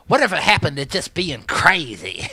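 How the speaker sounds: a touch of a comedian's loud punchline; Chris Rock. Retold or imitated. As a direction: deliver it like a stand-up punchline. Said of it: "like Chris Rock said".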